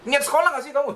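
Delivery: mock the child's effort, voice up high; sneering scold. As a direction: parent sneering at the child's schooling. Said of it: "Yang gitu ada loh".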